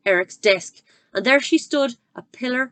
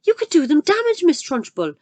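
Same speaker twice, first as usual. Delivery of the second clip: much higher, louder protest; cried out; 9 semitones higher, 7 dB louder than usual. Acted. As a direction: cry out in alarm. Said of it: "Miss Honey cried out".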